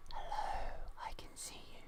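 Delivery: slow, close-up mock-creepy whisper. Shown as a demonstration. Showing listeners how the microphone sounds, whispering. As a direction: whisper right up against the microphone. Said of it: "going right up to the microphone and whispering".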